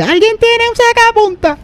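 very high, squeaky pupil voice; hammed-up high-pitched student; mock schoolkid. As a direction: call out like a kid in class needing help. Acting an unprepared pupil in class.

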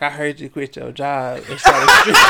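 laughing quote. Retold or imitated. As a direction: quote brother through laughter. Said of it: "he was like".

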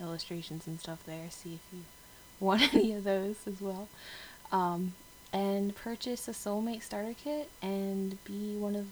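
ordinary delivery

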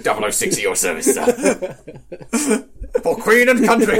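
high, loud eager servant voice; polite butler robot. Pretend. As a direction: chirp it like a keen butler.

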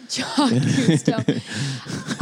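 low, very slow narrator intonation; dramatic documentary voice. Pretend. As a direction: draw the name out like a nature film. Mock dramatic echo of name.

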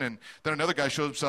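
normal talking voice

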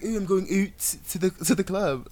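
mock Canadian accent. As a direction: imitate a singer's dramatic Canadian accent. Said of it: "Canadian accent. dramatic".

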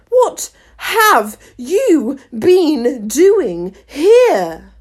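very slow, loud, high interrogation; booming royal question; imperious demand. A narrator's reading. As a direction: demand it word by word as a furious queen. Screaming queen questions the gardeners.

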